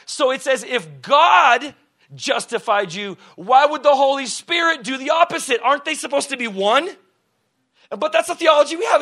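loud, high rising sermon; preaching at full force. Acted. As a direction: build to a fervent climax for the church.

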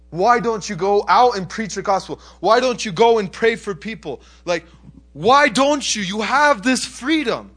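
loud, high, insistent questioning; retold plea delivered at preaching force; impassioned challenge. Retold believers challenge the Americans.

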